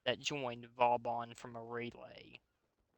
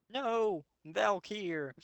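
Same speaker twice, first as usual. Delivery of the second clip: high ringing cry of despair; anguished cry; 8 semitones higher, much slower than usual. Acted. Oberon crying out as Valkyr is struck, high anguished yell. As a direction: cry out as a friend falls.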